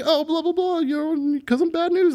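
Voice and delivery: high, dismissive mock voice; dismissive buddy. Coworker explaining his bad news tattoo, high and dismissive. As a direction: brush off the question like it's obvious.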